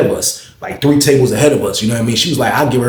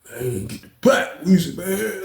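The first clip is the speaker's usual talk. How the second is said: tearful plea; 7 semitones higher, 7 dB quieter, slower than usual. Retold or imitated. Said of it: "he had tears in his eyes".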